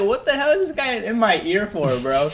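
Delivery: laughing disbelief at a stranger's whisper; baffled. Retold or imitated. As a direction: react like someone just spoke right into your ear. Retelling own baffled reaction.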